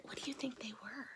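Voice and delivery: soft playful whisper; secretive whisper. A narrator's reading. Teacher whispering a teasing question to children.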